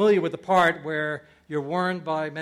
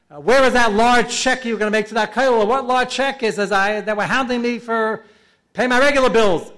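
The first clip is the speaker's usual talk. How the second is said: heavenly interrogation; 6 semitones higher, 9 dB louder than usual. Retold or imitated. Heavenly court questioning, man answering.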